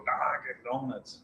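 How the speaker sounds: put-on slow, drawn-out regional accent on Dunkin'; boss's accent. Host teasing about how the boss says it, imitated accent. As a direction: mimic the boss's accent, stretching "Dunkin'" with an open vowel. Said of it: "that's what I hear the boss".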